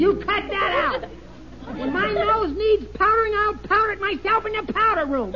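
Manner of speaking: indignant. Real, not acted. Husband teased, protests.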